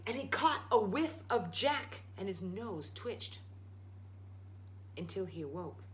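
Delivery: ordinary delivery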